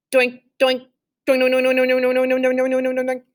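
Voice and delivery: bouncy pinging doinks; imitated metal clang. A narrator's reading. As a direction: mimic stones on metal. Imitated rocks hitting metal.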